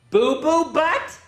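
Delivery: high, slow disbelief; incredulous. Pretend. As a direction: drag it out in disbelief. Disbelief at silly name.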